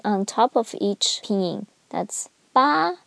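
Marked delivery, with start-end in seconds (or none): 2.5-3.0 "ba" high and level; high-level first tone. Shown as a demonstration. Teacher pointing out tone mark, "ba" high and level. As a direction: hold "ba" steady up high. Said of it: "first tone".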